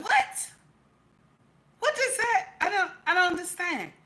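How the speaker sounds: high-pitched baffled questioning; incredulous, retold double take; confused. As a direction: act out total bafflement, voice jumping up. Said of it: "I was confused".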